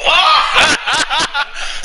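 high loud laugh; burst of laughter. Real, not acted. Gamer cracking up at the play, loud high laughter. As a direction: laugh out hard.

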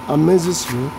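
usual speaking voice

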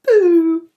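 loud yuck cry; loud disgusted pooh. Acted. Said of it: "Look at that badger saying".

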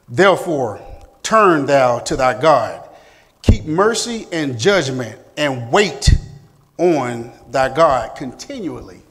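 slow, loud, emphatic exhortation; scripture reading. Acted. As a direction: urge the class to turn back to God. Reads scripture aloud.

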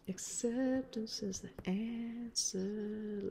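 drawn-out mock wisdom whisper; slow whisper. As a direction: whisper it like a guru. Mock wise saying, slow whisper.

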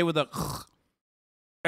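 drawn-out throaty scrape on "k"; Scouse K. Shown as a demonstration. Streamer demonstrates Scouse sound, long scraped "k". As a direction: stretch the "k" into a throat-clearing fricative. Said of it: "That's a Scouser accent, right?".

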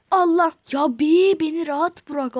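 loud exasperated cry to be left alone; frustrated outburst. Real, not acted. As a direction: burst out in annoyance. Enemy keeps attacking.

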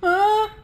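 short, high, loud yelp of fright; startled scream. Real, not acted. Player startled by monster lurking nearby, high yelp. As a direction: yelp as if jumped.

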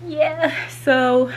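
panting after running up stairs; breathless, gasping between words; out of breath. Real, not acted. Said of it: "sorry I'm out of breath running up the steps".

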